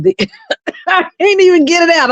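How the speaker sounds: choking on laughter, words high and fast; can't get it out for laughing; giggling through the words. Real, not acted. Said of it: "I'm over here choking".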